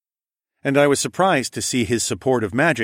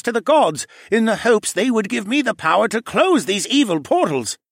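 pleading creature voice; 12 semitones higher, 5 dB louder than usual. Acted. Cadahy pleading his case.

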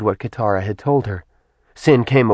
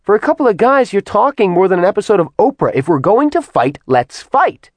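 much higher, impatient eye-rolling voice; exasperated; 12 semitones higher, 4 dB louder than usual. Acted. Kat mocking the men's chatter, exasperated and high. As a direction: get on with it, fed up. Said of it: "Kat rolled her eyes".

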